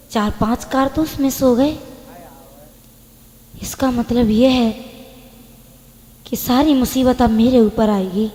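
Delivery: low, soft, half-to-self realisation; worried aside. Acted. Woman realising the bullets misfired, hushed worry. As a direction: say it quietly, thinking it through.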